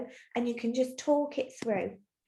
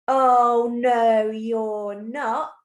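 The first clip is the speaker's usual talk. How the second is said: angry troll; 6 dB louder, much slower than usual. Acted. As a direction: boom it out like a cross troll. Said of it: "He's so angry".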